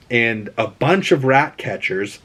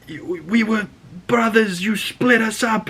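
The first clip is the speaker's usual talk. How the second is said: wounded brother; 9 semitones higher than usual. Retold or imitated. Dying twin's last words.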